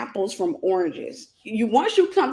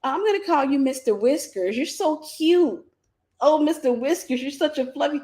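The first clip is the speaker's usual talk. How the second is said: cooing at a cat; 5 semitones higher than usual. Retold or imitated. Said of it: "And I'm like".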